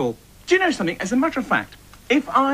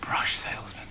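whisper; 11 dB quieter than usual. Acted. Hushed aside to audience.

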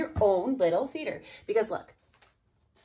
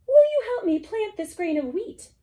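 high, louder pleading hen; hen asking; 9 dB louder than usual. Acted. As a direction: ask sweetly as the hen. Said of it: "She asked the cow".

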